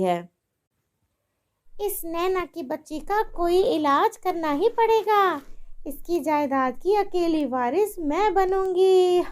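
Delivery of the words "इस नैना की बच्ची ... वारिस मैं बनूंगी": high scheming villain voice; wicked mother-in-law. Acted. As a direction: plot greedily to yourself.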